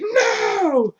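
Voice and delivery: very high, loud howl of no; anguished scream. Retold or imitated. Governor howling as his daughter is stabbed. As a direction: howl in despair.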